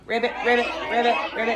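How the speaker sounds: playful croaking ribbits; frog voice. Shown as a demonstration. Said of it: "everybody talk like a frog".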